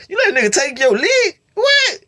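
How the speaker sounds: high, loud shocked yell; can't believe he let them take it; disbelieving shout. Retold or imitated. Teller yelling at his brother in disbelief. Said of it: "I'm like".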